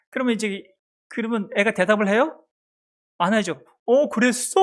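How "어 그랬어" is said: put-on higher-pitched baby-talk response; mother echoing her baby. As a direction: play a mom answering her infant's silence with keen interest. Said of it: "이렇게 또 맞장구를 쳐요".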